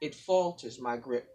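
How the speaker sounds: rhythmic spoken word, building in force; poem recital. Acted. Guest reading her poem to the audience, building spoken word.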